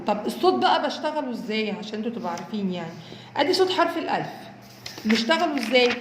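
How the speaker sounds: ordinary delivery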